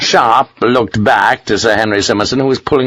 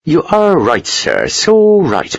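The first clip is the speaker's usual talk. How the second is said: higher, placating Irish lilt; Irish charm; 7 semitones higher than usual. A narrator's reading. Captain placating a protesting colonel, Irish charm. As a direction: agree soothingly with an Irish accent, drawn out. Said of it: "He unleashed all his Irish charm".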